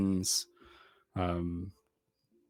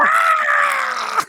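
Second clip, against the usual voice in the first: high loud monster screech; evil chicken screech; 12 dB louder than usual. Acted. GM voicing the evil cockatrice's shriek at the kid, high and loud. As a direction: shriek like a tiny demon bird. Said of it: "as it goes".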